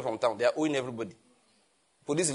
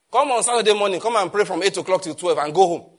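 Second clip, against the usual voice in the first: loud, raised-pitch scolding; exasperated exhortation; 4 semitones higher, 8 dB louder than usual. Real, not acted. Pastor scolding churches over all-night meetings, exasperated and loud. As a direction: lay down the law, loud and high.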